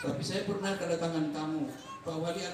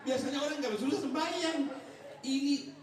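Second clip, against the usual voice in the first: high, laughing mock astonishment; mock amazement; 9 semitones higher than usual. Preacher mimics marvelling at the guest's excuse, mock amazed. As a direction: marvel at him in a much higher, teasing voice. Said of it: "Dia bilang".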